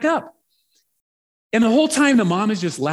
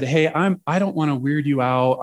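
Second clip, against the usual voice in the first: ordinary talk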